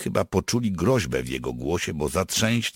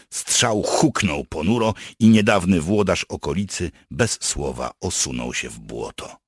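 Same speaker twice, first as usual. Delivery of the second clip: ordinary delivery, nothing marked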